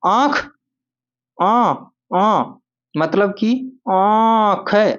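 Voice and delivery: आंख with a long, high nasal आ; drawn-out आ sound. Shown as a demonstration. Teacher showing students how आंख sounds. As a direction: stretch the आ in आंख slowly through the nose. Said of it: "इसका उच्चारण करेंगे तो कैसे करेंगे".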